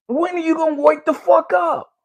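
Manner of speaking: raging rhetorical shout; angry shout. Real, not acted. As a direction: shout the question at them. Ranting at the audience.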